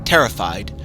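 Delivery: usual speaking voice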